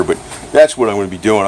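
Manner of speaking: ordinary delivery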